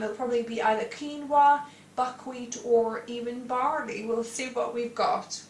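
usual speaking voice

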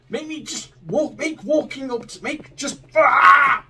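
high, strained cry of frustration; frustrated rant. Real, not acted. Gamer fed up with clunky controls, crying out high. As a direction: lose it at the controls.

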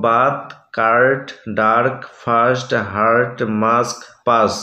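slow, long ar vowel in বাথ, কার্ট, ডার্ক. Shown as a demonstration. Teacher showing students the long ar sound, slow and deliberate. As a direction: stretch out the ar in হার্ট and মাস্ক slowly. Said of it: "এ ভাওয়েলের আর মতো sound পাচ্ছি".

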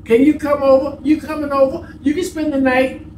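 put-on high, pleading girlfriend voice, lightly done; mock clingy girlfriend. Mother imitates son's girlfriend begging him over, high pleading. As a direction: coax him like an eager girlfriend.

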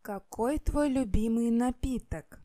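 ordinary delivery

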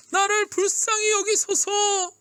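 very high, slow cry for mercy; pleading shout. A narrator's reading. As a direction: beg at the top of the voice. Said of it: "더 크게 외쳤어요". (힘껏 애원하는 외침)

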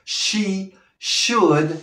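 SHOULD with a strong SH, no L; demonstrated pronunciation. Shown as a demonstration. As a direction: slowly model "should" with hushing SH. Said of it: "esa pronunciación". (pronunciación de "should" con "sh" marcada)

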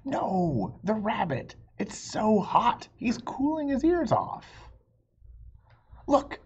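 lively, high storybook read-aloud; bright read-aloud with high character lines; reading to children. Acted. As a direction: voice the two arguing friends for kids. Reading picture book, two voices.

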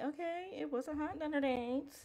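playful approval. Real, not acted. Player reacting to the hot dinner date buff, playful approval. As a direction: lift it high, teasing and impressed.

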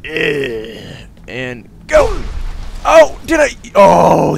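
high, loud yells of disbelief; triumphant shout. Real, not acted. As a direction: whoop as the stunt lands. Jump trick finally works.

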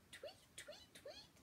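very soft high whispered tweets; whispered bird chirps. Acted. Bird chirps in kids' song.

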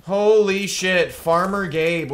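slow, loud, stretched-out shock; gasping at a Spitfire ambush; stunned exclamation. Real, not acted. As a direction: drag it out in disbelief. Sudden fight in game.

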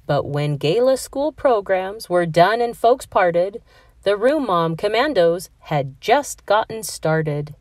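plain reading voice

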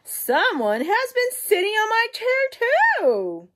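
very high, loud mother bear squeal; Mama Bear. Acted. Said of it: "Mama Bear goes to her medium-sized chair and said".

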